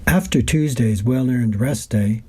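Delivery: ordinary talk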